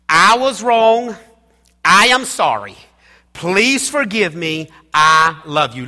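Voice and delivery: loud, emphatic declarations; preaching at full force. Shown as a demonstration. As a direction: proclaim each sentence to the congregation. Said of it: "those words should flow off of our lips".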